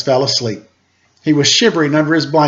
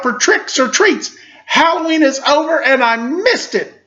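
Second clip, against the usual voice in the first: frustrated Sally; 10 semitones higher than usual. A narrator's reading. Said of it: "couldn't contain her frustration".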